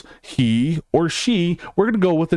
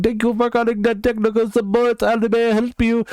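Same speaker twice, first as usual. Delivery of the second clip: lightly voiced, higher scam-call agent; mock tech support caller; 7 semitones higher than usual.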